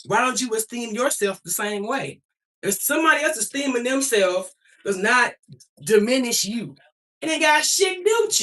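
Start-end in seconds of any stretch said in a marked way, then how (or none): none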